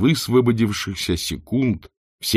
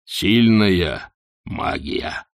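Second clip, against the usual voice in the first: barbarian voice; much slower than usual. Acted.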